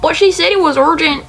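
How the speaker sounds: high-pitched, flustered worker voice; apologetic worker. Acted. Worker explaining the box mishap, apologetic and high.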